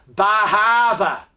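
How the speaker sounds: Bar Harbor as "Bah Hahbah", r's dropped; slow New England "Bah Hahbah"; New England accent. Shown as a demonstration. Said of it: "this woman obviously had a New England accent".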